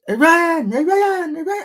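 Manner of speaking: high-pitched mock Cuban accent, calling out; hammed-up Cuban-accented holler, high and insistent; bad Cuban accent. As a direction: call out in a badly faked Cuban accent. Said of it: "I can't even do a Spanish voice, Cuban voice".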